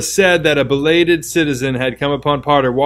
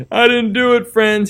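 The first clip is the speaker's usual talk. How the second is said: sobbing; 9 semitones higher than usual. Acted. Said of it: "he sobbed".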